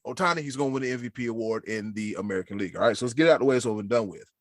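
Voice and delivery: normal talking voice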